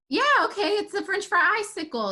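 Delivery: high, lifted indulgent voice; playing along. Man going along with a little girl, humouring her. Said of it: "He's like".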